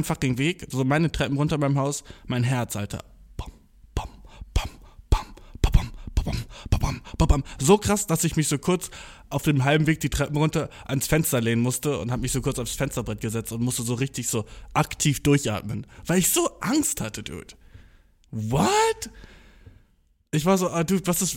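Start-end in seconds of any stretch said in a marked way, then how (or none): 3.4-7.4 pounding heartbeat mimicked with bam; voicing a racing heart on the stairs; heartbeat imitation. Scared on the stairs, mouthing a pounding heartbeat. 18.3-19.1 high startled squeak of a word; a jolt of panic; shocked what. Retold or imitated. Scared on the way to the party, a high startled what.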